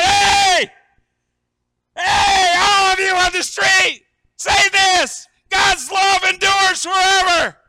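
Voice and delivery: full-throated high shout to people in the street; amped-up yell to passers-by; calling out the window. Acted. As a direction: holler it out, stretching the words. Psalmist yelling out window to street.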